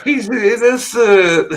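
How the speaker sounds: high, drawn-out mock protest of innocence; mock protester. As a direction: plead like an outraged bystander, hammed-up. Mocking protesters at an arrest.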